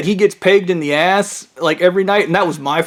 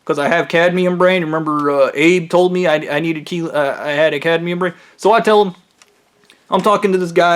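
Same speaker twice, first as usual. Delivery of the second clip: ordinary delivery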